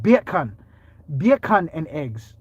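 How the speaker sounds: "bacon" said as "BEE-ah-kon", drawn out; Jamaican-style bacon. Shown as a demonstration. Correcting a misheard viewer, "bacon" said Jamaican as "byah-kon". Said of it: "You have to say bacon".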